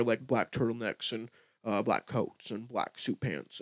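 put-on super low tone; Elizabeth Holmes. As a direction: talk way down low, impersonating her. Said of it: "a super low tone".

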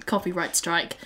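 usual speaking voice

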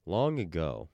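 low, calm storyteller voice; epic fantasy narrator. Pretend. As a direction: tell it like an ancient legend. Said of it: "my voice just went down ... super calm".

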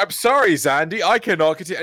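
high, loud, fast hammed-up apology; mock dramatic apology. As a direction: abandon the game with theatrical urgency, voice up and loud. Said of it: "And it's like".

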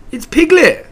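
high, loud burst of recognition; eager cry. A narrator's reading. Pooh recognising his friend's voice, crying eagerly. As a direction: cry it out eagerly, pitched right up. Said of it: "cried Pooh eagerly".

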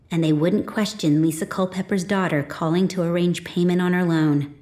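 ordinary delivery

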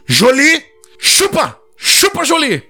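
high, insistent order to dog; stern command. Retold or imitated.

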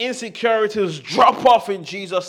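high and loud, building fervour; fervent, shouted affirmation; loud fervent declaration. Shown as a demonstration. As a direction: preach it with full force. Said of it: "As loud as you can".

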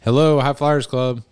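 slow, drawn-out radio host greeting; radio contest promo. Pretend. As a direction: announce it like a DJ on air. Hosts a radio call-in contest.

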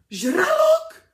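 high, loud, panicked shriek of a single word. A narrator's reading. Math teacher panics at a shark, shrieking. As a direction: scream it in terror, way up high. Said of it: "zaječel okamžitě Bušta".

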